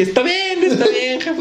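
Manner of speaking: very high, pleading 'all fine'; mock high-pitched reassurance. Son telling his mother the car is fine, mock placating.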